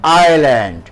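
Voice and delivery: "আইল্যান্ড" as eye-land, pitched low; a low, falling "আইল্যান্ড" with no S sound; silent S. Shown as a demonstration. Teacher repeats the word for pupils, "আইল্যান্ড" with silent S. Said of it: "S এর উচ্চারণটা silent থাকবে".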